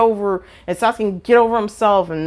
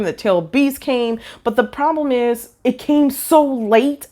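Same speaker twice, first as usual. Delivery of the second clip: usual speaking voice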